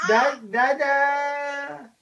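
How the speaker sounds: slow, stretched-out call; playful baby's call, drawn out; calling for Dada. Pretend. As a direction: hold the last vowel as if calling dad. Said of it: "have to call my dada".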